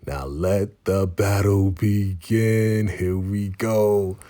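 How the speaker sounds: slow, drawn-out showdown announcement; fight announcer. Acted. Recapper heralds Monet against Diana, mock announcer. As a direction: stretch each word like opening a bout.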